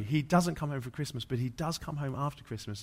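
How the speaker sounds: normal talking voice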